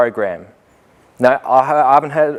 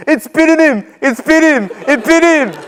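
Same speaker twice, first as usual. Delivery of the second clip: high, loud panicked yelling; hammed-up panicked boys' cry; mock yell; 4 dB louder than usual. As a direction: shout it down the hallway like a frantic kid. Boys yelling down hall.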